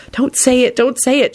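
high, frantic self-talk. A narrator's reading. Elizabeth begging herself not to speak, frantic. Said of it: "she told herself frantically".

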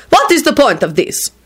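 resentful. A narrator's reading. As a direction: demand it with mild resentment. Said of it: "Radu told him resentfully".

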